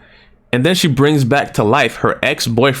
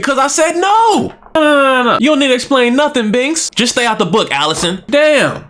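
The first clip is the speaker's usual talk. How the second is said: angry parent scolding; 12 semitones higher than usual. Pretend. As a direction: snap at the kid to sit down. Scolding the child again.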